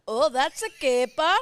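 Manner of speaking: put-on hammy Goldmember Dutch accent. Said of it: "I know that's Goldmember".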